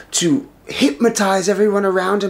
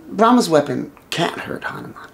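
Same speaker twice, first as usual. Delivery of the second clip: plain reading voice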